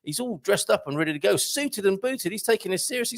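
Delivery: ordinary talk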